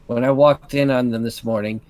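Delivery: low, sour resentment; bitter. Acted. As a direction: grumble it with bitter scorn. Catherine bitter about the lovers.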